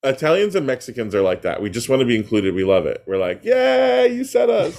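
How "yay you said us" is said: high, loud hammed-up "yay" of delight; exaggerated high-pitched celebration; mock cheer. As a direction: cheer as if thrilled to be included, laugh creeping in. Acting out delighted Italians being included.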